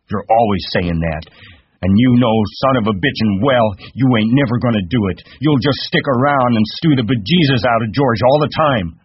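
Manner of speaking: high, sharp nagging aunt; sharp scolding. Acted. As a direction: lay into him reproachfully. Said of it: "she said sharply".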